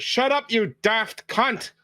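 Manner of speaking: high, slow, angry holler; angry shout. Acted. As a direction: yell abuse out of the window. Acted yelling out of a window.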